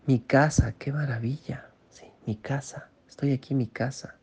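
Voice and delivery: soft, breathy murmur of awe; hushed amazement. Shown as a demonstration. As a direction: marvel quietly as if seeing your house for the first time. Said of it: "Así como".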